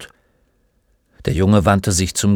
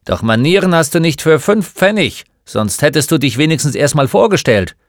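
loud, higher-pitched scolding; grumpy old shopkeeper; 7 semitones higher, 6 dB louder than usual. Acted. Old man scolds a boy for not introducing himself, grumpy and loud. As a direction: scold like a crusty shop owner.

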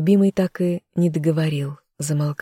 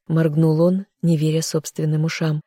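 ordinary delivery, nothing marked

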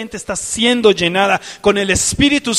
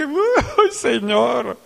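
overwhelmed sigh; 3 semitones higher, much slower than usual. Retold or imitated. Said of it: "tu cuerpo no alcanza".

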